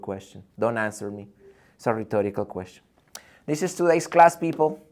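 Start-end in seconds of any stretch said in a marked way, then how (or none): 3.5-4.8 calling the class to attention, loud; loud classroom call. Real, not acted. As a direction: announce it to the room.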